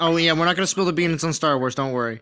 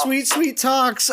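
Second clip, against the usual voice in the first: drawn out slow, pitched higher, crooning the name; mock sweet-talk; 5 semitones higher, much slower than usual. Friend mocking Val sweet-talking Talks, slow and crooning. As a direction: stretch each word like a syrupy flatterer.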